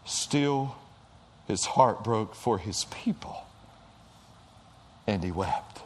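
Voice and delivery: tender hushed awe. Real, not acted. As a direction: let it drop almost to a whisper.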